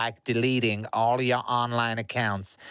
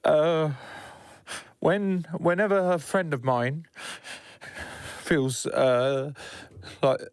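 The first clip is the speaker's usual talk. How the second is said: high, hesitant, frightened voice; nervous, close to crying; scared, near tears; 5 semitones higher, slower than usual. Acted. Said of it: "in the manner of a deeply scared, paranoid person who is on the edge of possibly tears".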